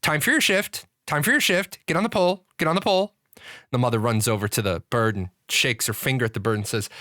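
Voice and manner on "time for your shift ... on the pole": high parrot voice repeating each line; squawking parrot. Acted. Parrot orders the daughter to her shift, high squawk. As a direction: chant it like a trained bird.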